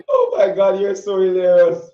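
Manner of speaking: high, loud, coming out of a big laugh; amused outburst. Real, not acted. Host to her singer guest after his confession, loudly amused. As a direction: exclaim it while cracking up.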